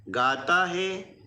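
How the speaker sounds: गाता है slowly, the आ drawn out; slow aa demo. Shown as a demonstration. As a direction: hold the आ in गाता. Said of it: "आ और ई की मात्राओं के उच्चारण अलग-अलग". (गाता धीरे, आ लंबा खींचें)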